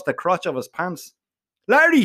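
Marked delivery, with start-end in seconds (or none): 1.7-2.0 shouted name. Acted. Boy yelling to his frozen friend, loud high shout. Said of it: "shouts I".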